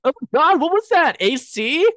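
high-pitched imitated bystander alarm; mock startled. Bystander reacting to a sudden gust, mock startled. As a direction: exclaim in an exaggerated high voice.

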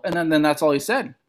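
normal talking voice

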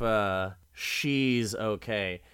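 ordinary delivery

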